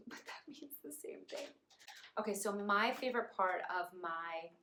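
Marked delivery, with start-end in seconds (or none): 0.0-1.5 breathy laughing aside; laughing whisper. Real, not acted. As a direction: giggle it under your breath.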